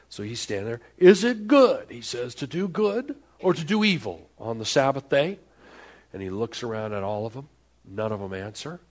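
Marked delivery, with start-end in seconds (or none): 1.0-5.4 loud, high challenge; Jesus confronting the Pharisees, loud and high; challenging. Acted. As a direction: throw the question at the room.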